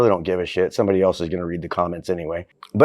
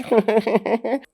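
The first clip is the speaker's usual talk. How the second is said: high laugh; 12 semitones higher than usual. Real, not acted. Laughs after kid voice.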